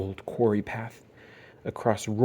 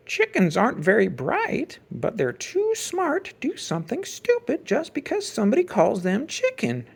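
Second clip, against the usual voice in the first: higher, lightly voiced farmer giving a lesson; kindly old farmer; 11 semitones higher than usual. A narrator's reading. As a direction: speak like a wise old farmer teaching a cub.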